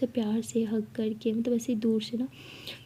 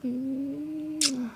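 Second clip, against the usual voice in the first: long drawn-out "mmm" of a kiss; soft sustained kissing moan; acted kiss hum; 3 semitones higher, 5 dB quieter, much slower than usual. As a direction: hum a slow, lingering kiss.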